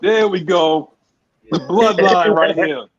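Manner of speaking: hype shout. Acted. Wrestling bloodline hype.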